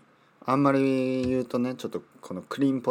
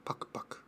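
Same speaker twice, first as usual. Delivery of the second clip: whispered munching; 14 dB quieter, faster than usual. Shown as a demonstration. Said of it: "パクパクパクっていうかな？".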